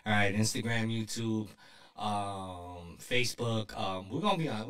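low smooth radio DJ voice; sexy radio voice. Pretend. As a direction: lay on the low radio charm. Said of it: "talk in my sexiest voice".

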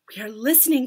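plain reading voice

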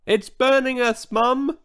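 high pained little cries; high baby voices crying to their mum; babies crying out. A narrator's reading. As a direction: squeal it like hurt babies. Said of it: "they cried".

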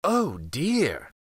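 sly fox faking alarm on "Oh dear!"; lightly voiced crafty fox sighing "Oh dear!"; fox's mock concern. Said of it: "the fox said".

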